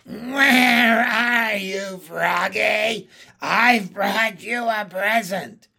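high, loud, slow witch voice; drawn-out taunting crone call; cackling hag. A narrator's reading. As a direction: call out in mock sweetness as a witch. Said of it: "while she cackled softly".

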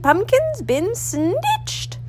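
high, slow wail of an ancient woman; ancient woman's cry. A narrator's reading. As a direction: draw out the old crone's outcry, up high. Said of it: "she cried in a seven hundred-year-old voice".

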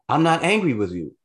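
imitating her snapping back. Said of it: "you're raising your voice while we're in public".

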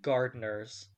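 upbeat show-opening announcer read; podcast host intro. Acted. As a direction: welcome the listeners like a radio host.

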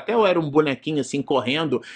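usual speaking voice

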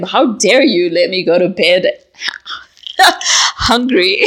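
how dare you, breaking into laughter at hungry; laughing accusation. Retold or imitated. Waking her partner to scold him, laughing. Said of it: "I'm like to him".

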